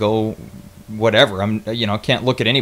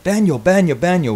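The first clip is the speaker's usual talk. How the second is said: lightly voiced old guy, pitched up; urgent repeated call, mimicking a local; quick high imitation of an old man; imitated old man; 7 semitones higher than usual. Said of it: "Old guy comes up and he's like".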